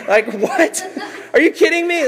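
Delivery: high-pitched laughing disbelief; comic squeaky protest; incredulous. Acted. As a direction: react in hammed-up astonishment, voice leaping up. Mock disbelief at the Pharisees' accusation.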